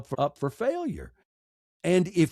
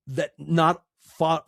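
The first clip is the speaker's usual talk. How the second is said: ordinary talk, nothing marked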